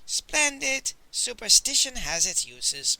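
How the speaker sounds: pleased, high first word then settling; happily. A narrator's reading. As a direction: exclaim with mild delight. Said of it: "Master Li said happily".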